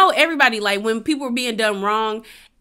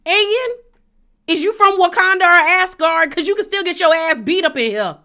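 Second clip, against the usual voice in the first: mocking; 8 semitones higher, 5 dB louder than usual. Real, not acted. Mocking Bryn's ethnicity claim.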